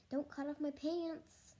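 put-on high-pitched boyish plea; alarmed protest. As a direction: protest with mild panic. Boy protests to teacher.

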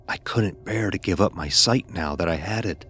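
usual speaking voice

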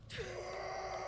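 long tired sigh; weary sigh. Real, not acted. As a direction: sigh out in disbelief. Reacts to game setback.

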